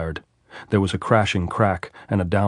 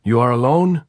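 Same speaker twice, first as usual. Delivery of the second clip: louder call from above; 6 semitones higher, 6 dB louder than usual. A narrator's reading. Sentry calling down to Andres again, loud. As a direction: check once more, calling. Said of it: "the voice called down again".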